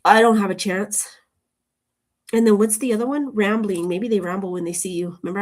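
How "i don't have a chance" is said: mock insecure.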